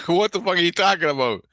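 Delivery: loud, high, baffled outburst; incredulous retort. Retold or imitated. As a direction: fire back in loud disbelief. Baffled retort after "fucking crazy.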